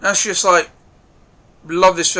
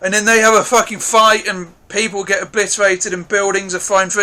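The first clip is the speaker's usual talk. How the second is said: normal talking voice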